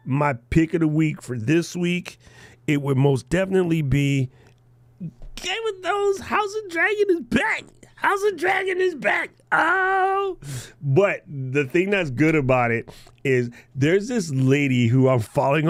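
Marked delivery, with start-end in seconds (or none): 5.4-10.4 high, thrilled squeal of joy; excited squeal. Real, not acted. Hosts thrilled about House of Dragon back, squealing. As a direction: squeal it out like big news. Excited announcement of show return.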